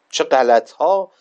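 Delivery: loud, slow indignant rebuke; indignant outburst. Retold or imitated. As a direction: hurl it back at the governor, dragging each word. Imam rebukes the governor.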